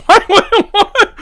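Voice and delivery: high, loud laughing disbelief. Real, not acted. Host baffled by the statement, laughing hard. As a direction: break up laughing while asking. Cracks up at the quote.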